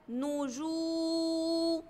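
slow "نجوم", the ju sustained on one note; long waw vowel. Shown as a demonstration. Teacher introduces the word, "نجوم" with oo greatly prolonged. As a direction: hold the oo in "نجوم" long, then a separate mu. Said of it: "عندي مد بالواو".

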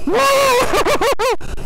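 high startled yelp; real shock as the bike lurches; surprised whoa. Real, not acted. Bike surges up the steep hill.

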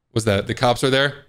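normal talking voice

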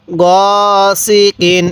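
গ held long and level, then সি কিন; recitation model. Shown as a demonstration. Teacher showing the learner how to read, drawn-out গ সি কিন. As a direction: draw out গ before measured সি কিন. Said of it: "আরেকবার পড়ুন".

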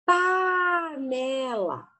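Panela dragged out syllable by syllable; teacher overarticulating Panela for the mirror; very slow demo. Shown as a demonstration. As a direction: exaggerate every syllable of Panela. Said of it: "Usem espelhinho".

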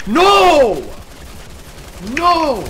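shouting no. Real, not acted. Getting killed in game.

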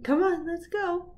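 high, loud urging; urging excitedly. Real, not acted. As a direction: egg the dog on. Urging dog on video.

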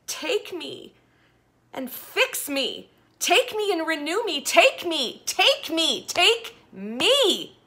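anguished shouting prayer, rising in pitch; desperate scream. Acted. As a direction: cry out to God with everything. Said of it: "I screamed out and I said".

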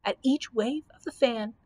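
plain reading voice

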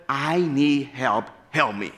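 normal talking voice

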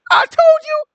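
very high, whiny told-you-so; exasperated king. Retold or imitated. Ahab griping to Jehoshaphat, very high and whiny. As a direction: complain in a high, squeaky voice.

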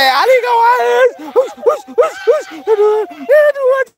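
very high, frantic yelling; panicked shouting. Real, not acted. Panicked shouting, shooing something away. (bengok-bengok dhuwur kanthi panik)

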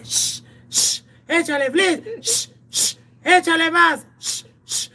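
spray hiss then high urging voice; imitated spray hiss. Retelling the police spraying the drunks, hissing spray sounds and high urging. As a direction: hiss like pepper spray, then egg them on. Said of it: "y que les hace".

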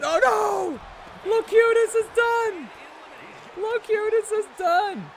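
very high, loud exclamation; excited commentary. Real, not acted. Streamer calling Locutus is done, high excited cry. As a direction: cry out in high-pitched alarm. Streamer calls wrestler's elimination.

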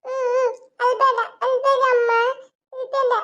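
put-on high-pitched toddler voice; little girl consoling. Small daughter telling mother not to cry, high child voice. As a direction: comfort someone in a put-on baby voice.

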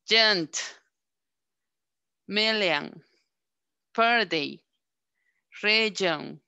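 slow, careful word list for the learners; teacher modelling schwa words one by one; schwa pronunciation drill. Shown as a demonstration. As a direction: model each word with a lazy unstressed "a". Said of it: "Ahora pronunciemos el sonido A".

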